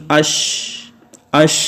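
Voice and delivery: اش with the sh fading on a steady breath; اش ending in soft escaping air; breathy ish. Shown as a demonstration. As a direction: let the breath run out on اش. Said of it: "سانس جاری رہتا ہے اور آواز پست ہو جاتی ہے".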